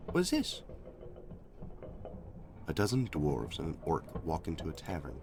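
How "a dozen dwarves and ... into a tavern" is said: lower, softer voice reading the joke; deadpan storyteller voice; low read dialogue. Acted.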